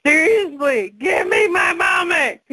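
fed-up mom begging for her moment; exasperated outburst. Retold or imitated. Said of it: "I know I'm about to snap".

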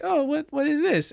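put-on girl's confused voice. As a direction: squeak it out like a baffled kid. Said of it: "and she's like".

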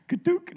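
high, loud comic crash noise; mock crash. Pastor jokingly imagines smashing the door, high mock crash noise. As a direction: blurt it like a door giving way.

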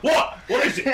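high, loud shouted question; loud yell. Acted. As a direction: yell it down from the crow's nest. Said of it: "he yells out really loud".

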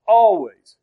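"always" hit loud, standing alone; emphatic. Shown as a demonstration. Preacher names how often to rejoice, emphatic. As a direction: punch "always" as one loud, falling word. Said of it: "time description of how often".

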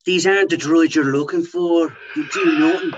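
high, loud, put-on Star Wars voice; Obi-Wan Kenobi. Obi-Wan taunting his mates, hammed up loud and high. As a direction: ham up a bad Obi-Wan. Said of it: "You're really bad at accents".

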